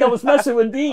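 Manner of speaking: high mock protest; exasperated explanation. Prankster clears things up with security, protesting.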